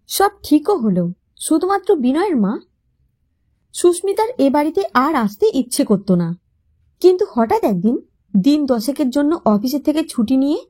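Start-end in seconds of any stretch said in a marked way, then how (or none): none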